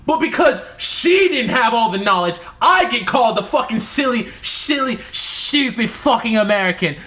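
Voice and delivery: worked up and exasperated; indignant rant. Real, not acted. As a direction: complain with heat about an unfair insult. Ranting about being insulted by sister.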